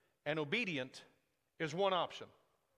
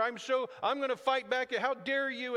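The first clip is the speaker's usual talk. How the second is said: put-on higher, louder indignant kid; hammed-up defiant little one; mock child anger; 7 semitones higher, 5 dB louder than usual. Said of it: "or they try anger".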